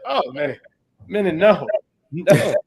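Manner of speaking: dismayed protest. Retold or imitated. Retold reaction to harsh film line.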